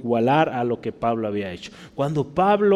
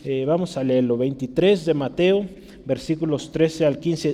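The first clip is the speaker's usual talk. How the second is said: usual speaking voice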